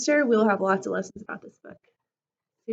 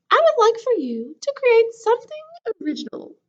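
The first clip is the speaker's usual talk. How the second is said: announcing; 11 semitones higher, 7 dB louder than usual. Acted. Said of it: "she announced".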